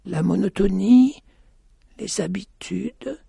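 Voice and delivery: a weary voice reciting the sameness of life; blind woman. A narrator's reading.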